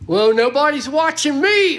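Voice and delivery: high, loud mock voice of a careless churchgoer; hammed-up careless churchgoer. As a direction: sneer it like someone who thinks nobody sees.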